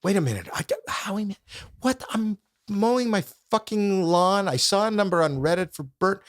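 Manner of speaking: a man mowing his lawn, utterly lost; confused prank victim. Retold or imitated.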